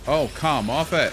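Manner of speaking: loud, exasperated come off it; exasperated outburst. Real, not acted. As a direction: blurt it out in disbelief. Game going badly.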